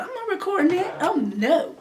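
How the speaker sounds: high, loud indignant refusal; mock-outraged no from the sister; sister's sharp refusal. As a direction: snap back like a sister who won't budge. Sister flatly refusing to record.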